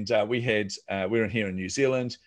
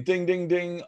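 higher, chiming ding sounds; bell imitation; 8 semitones higher, slower than usual. Mimicking the coffee bell going off in the cafe queue, pitched up.

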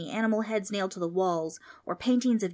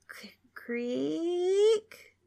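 creak; 10 semitones higher than usual. A narrator's reading. Door creaks open.

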